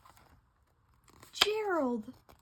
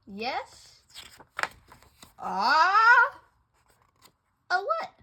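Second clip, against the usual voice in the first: ordinary delivery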